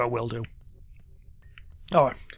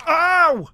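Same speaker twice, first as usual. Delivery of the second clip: sudden ow; 13 semitones higher, 7 dB louder than usual. Real, not acted. Rat leaps and bites him.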